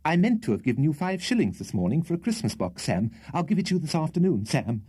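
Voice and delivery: a hint of an anxious toff currying favour; nervous Mr Winkle. A narrator's reading. Winkle voiced by narrator.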